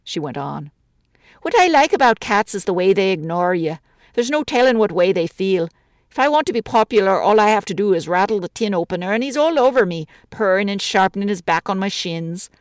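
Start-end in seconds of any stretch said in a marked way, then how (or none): 1.4-12.5 lightly voiced Irish widow, chatty grumble; a lonely woman complaining about the city; a hint of an older woman's voice, gently aggrieved. Woman talking about cats.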